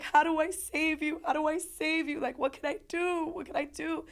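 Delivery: high, loud frantic pleading; re-enacted tearful desperation; crying plea. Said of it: "And I'm crying".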